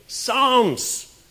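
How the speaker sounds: high, loud, drawn-out retold shout; shouting at radio. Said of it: "And I was shouting at the radio".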